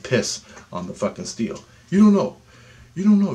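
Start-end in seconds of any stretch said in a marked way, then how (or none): 1.9-3.3 much higher and louder, hammering DONT; emphatic insistence. Real, not acted. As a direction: drive it home to the listener. Urging listeners, insistent repeat.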